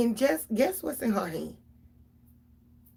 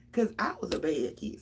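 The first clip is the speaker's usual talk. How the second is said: ordinary talk, nothing marked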